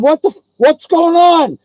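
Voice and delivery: high, loud alarmed outcry; panicked mimicry. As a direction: cry out as the bitten friend. John panics as dog bites him.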